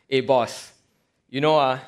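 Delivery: Singaporean English, casual and chatty; Singlish. Retold or imitated. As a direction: disciples pleading in Singlish. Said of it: "allow me to paraphrase this in Singlish".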